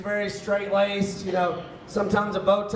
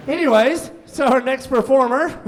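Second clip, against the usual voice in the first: louder, higher emcee call; brisk host announcement; 4 semitones higher, 6 dB louder than usual. Real, not acted. Comedy host introducing the next performer, loud announcing. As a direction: lift it up to introduce an act. Host moves show on after story.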